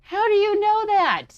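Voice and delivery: high, loud startled question; surprised shout. Acted. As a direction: blurt it out in shock. Startled heroine questions robot.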